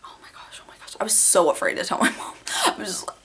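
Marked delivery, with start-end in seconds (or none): 0.0-0.9 hushed, breathless oh my gosh; panicked whisper. Retold or imitated. Teen discovering the dog chewed her retainer, horrified whisper. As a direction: whisper it in horror so nobody hears.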